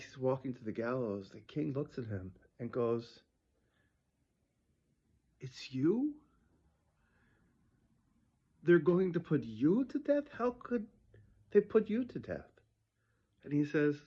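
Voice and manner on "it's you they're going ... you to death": high-pitched, incredulous questioning; astonished, punching the word you; shocked disbelief. Acted. King recognizing his condemned friend, shocked disbelief.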